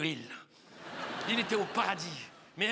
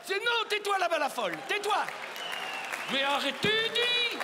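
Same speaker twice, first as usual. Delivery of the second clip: high, exasperated shout; mock scolding; 9 semitones higher than usual. Comedian tells a laughing spectator to hush, mock-exasperated. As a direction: scold a heckler in comic outrage.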